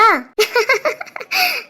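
high soft laughter; amused laugh. Real, not acted. Aunt laughs as the baby answers with a cry.

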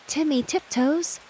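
high-pitched, scolding call. A narrator's reading. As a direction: call down a hole to someone below. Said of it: "and called down".

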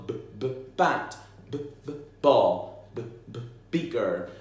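b, b, bat with the b repeated before the word; initial sound stressed. Shown as a demonstration. Teacher showing children the first sound, repeated b. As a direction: tap out the b twice then say bat. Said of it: "hear what sounds at the beginning".

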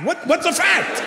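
high-pitched baffled son; squeaked, mock-exasperated question; bewildered child. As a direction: imitate an incredulous kid. Child argues back at dad.

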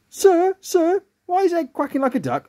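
very high schoolchild voice; excited pupil. Acted. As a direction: call for the teacher's attention, pitched right up. Pupil calls out to teacher.

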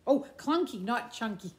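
ordinary delivery

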